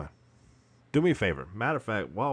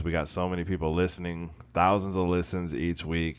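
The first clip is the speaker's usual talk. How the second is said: normal talking voice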